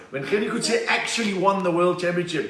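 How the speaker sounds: ordinary delivery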